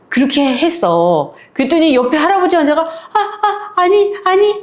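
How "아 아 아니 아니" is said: high, dragged-out protest; the mute old man struggling to say no; slow strained pleading; desperate old man. Retold or imitated. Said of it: "막 이러는 거야".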